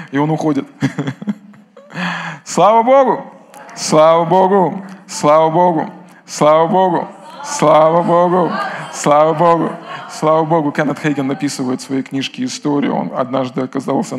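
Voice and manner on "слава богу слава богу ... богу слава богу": loud, repeated praise; joyful shout. Real, not acted. (радостный возглас)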